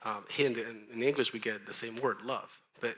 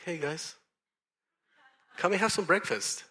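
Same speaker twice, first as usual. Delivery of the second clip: higher, louder friendly call; breezy invitation; 6 semitones higher, 5 dB louder than usual. Retold or imitated. Jesus calling the disciples to breakfast, breezy invitation. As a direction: call the guys over casually.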